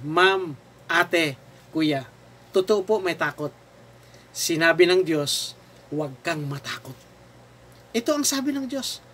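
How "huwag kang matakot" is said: soft, low and tender; calm, lowered voice of reassurance; gentle reassurance. Retold or imitated. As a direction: quote it as a hushed comfort. Preacher voices God's reassurance.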